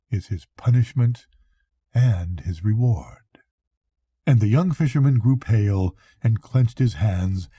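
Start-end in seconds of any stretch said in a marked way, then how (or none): none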